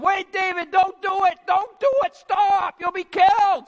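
high, loud panicky shouting; alarmed calling out. Acted. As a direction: shout after someone running into danger. Calling after David as he runs off.

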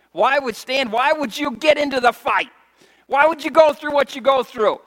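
rising fervent sermon questions; preaching hard. Acted. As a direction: drive the questions home from the pulpit. Preaching at full force.

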